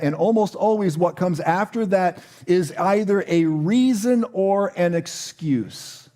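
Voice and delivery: ordinary talk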